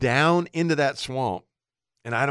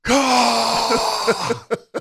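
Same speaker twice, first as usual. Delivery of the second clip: high laugh; 11 semitones higher, 3 dB louder than usual. Real, not acted. Laughing at the memory.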